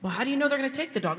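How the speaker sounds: quick worried objection from husband; husband objecting. Retold or imitated. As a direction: mimic him fretting fast. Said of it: "He's like".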